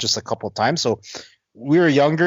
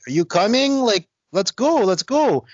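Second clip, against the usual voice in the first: higher mock girl voice, eager; urging girl; 11 semitones higher than usual. French girl calling from the cab, higher, eager. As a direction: pitch it up like the girl pleading.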